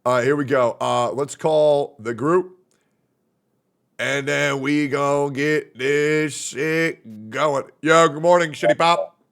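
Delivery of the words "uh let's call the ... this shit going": ordinary talk, nothing marked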